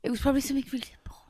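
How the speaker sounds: fast unvoiced whisper; sarcastic whisper. Real, not acted. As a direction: whisper it dryly on the side. Sarcastic aside.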